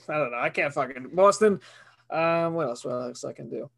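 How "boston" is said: loud Boston accent; broad hammed-up Boston. Shown as a demonstration. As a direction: punch it out in Boston dialect. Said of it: "Oh, my Boston accent".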